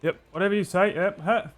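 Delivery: high, servile agreement; nervous patron. Acted. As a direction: grovel and scurry off. Patron grovels to paladin.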